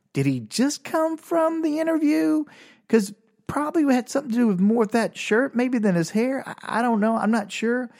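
high, disbelieving inner voice; incredulous thought. Retold or imitated. Musing about the man's pig shirt, high and incredulous. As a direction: wonder aloud in high disbelief.

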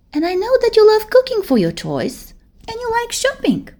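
put-on a big girl calming her scared friend; soothing older girl. Gabby advising.

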